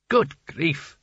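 a hint of an otherworldly talking hat. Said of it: "When the hat spoke".

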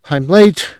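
high, hurried exclamation. A narrator's reading. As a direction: blurt it out in a rush. Wife rushing late.

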